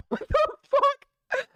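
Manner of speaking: startled outburst at the screen; shocked squeal. Real, not acted. Shock at the scene.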